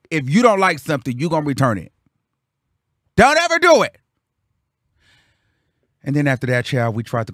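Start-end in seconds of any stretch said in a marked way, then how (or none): 3.2-4.0 high, loud, exclaimed warning; emphatic warning. Real, not acted. Warning viewers about the restaurant, emphatic. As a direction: shout the warning in a raised pitch.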